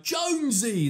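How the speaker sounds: high, loud shocked yelp of a name; mock his startled outburst; hammed-up shocked shout; shocked cry. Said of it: "he's so shocked when he's like".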